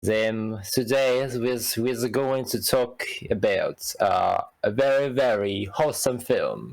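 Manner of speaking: hammed-up fake French accent; a mock-French host greeting the listeners. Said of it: "this is my French accent".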